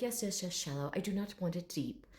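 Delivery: soft, lightly fussy dragon voice; quietly specify it with formal precision; proper gentleman. Retold or imitated. Dragon describing his water dish, quietly proper. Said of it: "this very proper gentleman".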